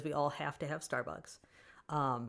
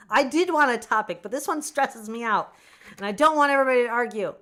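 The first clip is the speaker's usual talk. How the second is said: stressed outburst; 6 semitones higher, 11 dB louder than usual. Real, not acted.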